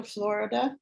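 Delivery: ordinary talk, nothing marked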